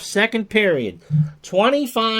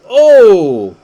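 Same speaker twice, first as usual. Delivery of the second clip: long, booming commentator's "oh" at a big hit; loud shocked "oh"; 2 semitones higher, 12 dB louder than usual. Real, not acted. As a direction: burst out with a drawn-out, falling holler. Reacting to a hit in play.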